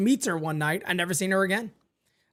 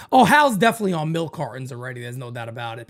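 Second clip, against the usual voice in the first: ordinary talk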